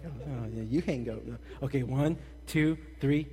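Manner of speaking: mock sweetheart on the phone, low and soft; lightly acted late-night phone murmur; lovey-dovey teen. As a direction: softly tease your sweetheart.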